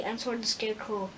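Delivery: plain reading voice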